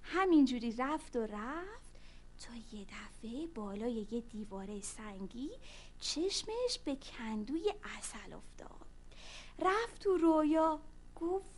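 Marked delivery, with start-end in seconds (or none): 2.5-8.9 whispered, slowing down; suspense whisper. A narrator's reading. Storyteller whispering the discovery to children. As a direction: hush the kids for the reveal.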